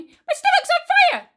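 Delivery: high, loud shriek of alarm; sudden shout. A narrator's reading. Grandma after swallowing the medicine, shrieking high. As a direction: yell it as if burning inside. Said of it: "she shouted suddenly".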